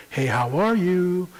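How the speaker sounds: stretched imitation of a greeting; certain tone of voice. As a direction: mimic the same loaded hello, drawn out. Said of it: "had a certain tone of voice".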